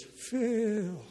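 high, drawn-out ghostly call; eerie voice. Retold or imitated. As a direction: moan the name like a ghost. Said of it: "And then he did it real eerily".

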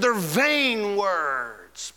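very slow, scornful "vain words"; drawn-out contempt on "vain"; Pharaoh's dismissal. Acted. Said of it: "Pharaoh said".

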